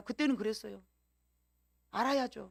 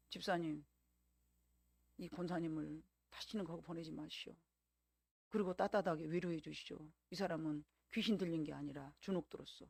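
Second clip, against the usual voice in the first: soft, low retold advice; quiet counsel; 5 semitones lower, 9 dB quieter than usual. Preacher advising a deacon about an intimidated church member, quiet and low. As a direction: lower the voice into grave, gentle instruction. Retells own counsel to the woman's relative.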